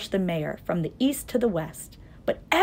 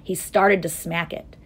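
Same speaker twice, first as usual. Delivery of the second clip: ordinary delivery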